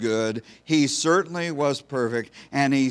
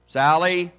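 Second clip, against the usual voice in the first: raised roll-call call of a name; teacher calling roll; 4 semitones higher, 5 dB louder than usual. Retold or imitated. Teacher calls the roll to the class, raised roll-call voice.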